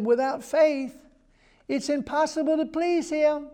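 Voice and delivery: very high-pitched angel voice, held up all through; high-pitched heavenly messenger; high angelic voice. Acted. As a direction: imitate an angel speaking far above your own range. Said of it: "like an angelic voice".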